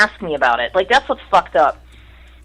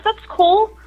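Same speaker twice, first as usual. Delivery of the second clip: breezy brush-off; 11 semitones higher, much slower than usual. Retold or imitated. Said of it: "I'm like".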